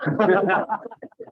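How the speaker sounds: amused laugh after a punchline. Real, not acted. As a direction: laugh at your own joke. Laughs at own pizza story.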